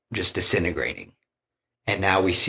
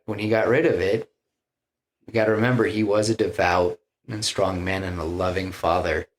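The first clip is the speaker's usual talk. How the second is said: ordinary delivery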